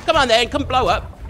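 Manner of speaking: normal talking voice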